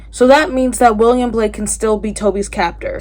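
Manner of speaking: ordinary delivery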